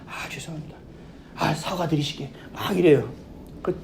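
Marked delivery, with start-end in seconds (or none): 0.1-2.3 much softer, half-whispered sorry; bow and murmur an apology; hushed apology. Retold or imitated. Manager quietly begging the customer's pardon, hushed apology.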